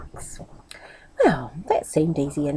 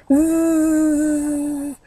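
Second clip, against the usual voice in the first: fly buzz; 8 semitones higher than usual. A narrator's reading. Fly buzzing, higher.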